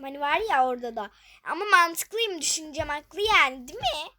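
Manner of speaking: loud, fast self-defence; loud insistence. Real, not acted. Gamer to viewers defending her guess, loud insistence. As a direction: argue you were right, loudly.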